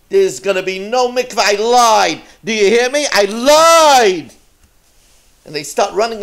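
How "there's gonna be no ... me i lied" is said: growing louder, defiant yell; angry shout. Retold or imitated. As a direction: bellow the confession at them. Miser drives townsmen off with dogs.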